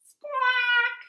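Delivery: high, loud parrot squawk; macaw squawk. Acted. Librarian reads to kids, high parrot squawk. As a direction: screech it like a bird. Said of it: "says the macaw".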